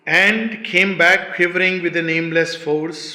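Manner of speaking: loud, declaimed poetry with drawn-out words; emphatic verse recitation. Acted. Teacher rereading mystic verse to listeners, loud declamation. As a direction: declaim each phrase with force.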